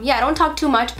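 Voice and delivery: ordinary delivery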